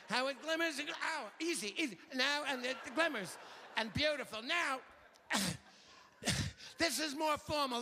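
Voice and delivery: high, gushing home-shopping pitch; TV shopping host. Pretend. Hawking bracelets to viewers in a high salesy voice. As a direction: sell the jade like a cable shopping host.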